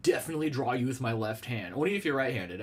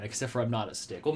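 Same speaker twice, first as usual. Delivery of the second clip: ordinary delivery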